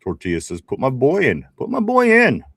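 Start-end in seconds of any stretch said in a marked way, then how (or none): 1.6-2.4 louder, much higher, like hollering from the stands; mock rallying shout. Host acting out Tortilla's chat demand, mock rallying shout. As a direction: shout it high and loud.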